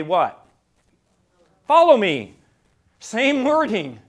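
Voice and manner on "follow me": a loud, ringing call of Jesus' command. Acted. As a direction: proclaim it loudly to the congregation. Congregation completes Jesus' words.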